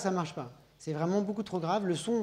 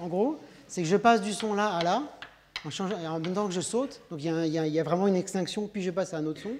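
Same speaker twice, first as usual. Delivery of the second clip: ordinary delivery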